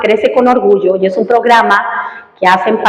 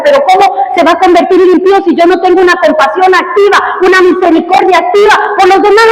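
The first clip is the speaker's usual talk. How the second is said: raised, driving pulpit voice; fiery preaching; 6 semitones higher than usual. Acted. Pastor urges his congregation to compassion, fiery preaching. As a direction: preach it hard and pushing.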